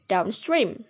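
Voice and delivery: "downstream" with main stress on "stream"; primary stress on second word. Shown as a demonstration. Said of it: "The primary stress is placed on the second word".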